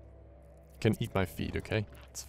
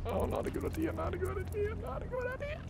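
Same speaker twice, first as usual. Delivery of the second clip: rising higher with each repeat, breathless; panicked squeak; 7 dB quieter than usual. Real, not acted. Gamer squeaking at the approaching sea monster, panicked. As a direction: panic climbing as the monster nears.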